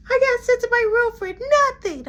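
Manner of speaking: put-on very high, loud childish voice; squeaky high upset kid; upset girl voice. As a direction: play a sulky young girl.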